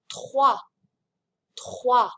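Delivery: "Trois" with R in the throat, then "wa", silent S; throaty French R. Shown as a demonstration. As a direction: model "Trois" carefully, R from the throat. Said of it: "I say the R in my throat".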